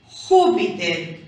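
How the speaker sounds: "Júpiter" slowly, leaning on Jú; drawn-out "Júpiter" showing the stressed Jú; stressed first syllable. Shown as a demonstration. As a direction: punch the opening of "Júpiter". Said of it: "cargamos la voz".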